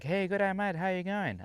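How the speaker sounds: high-pitched, hearty Australian greeting, imitated; friendly Aussie greeting. Camper greeting a stranger at the camp kitchen, imitated friendly Aussie accent. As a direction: imitate a chummy Aussie saying hello. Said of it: "he went on like".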